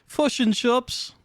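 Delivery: high, slow mock Kiwi; attempted Kiwi accent. Shown as a demonstration. Streamer having another go for chat, exaggerated high Kiwi accent. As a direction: push the Kiwi vowels, pitched right up. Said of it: "I don't know how to do a Kiwi".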